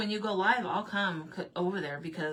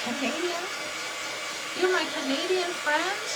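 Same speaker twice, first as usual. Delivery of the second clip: much higher, delighted squeal; excited squeal; 11 semitones higher, 3 dB louder than usual. Real, not acted. Greeting a Canadian viewer, squealing. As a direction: squeal with delight at a new friend.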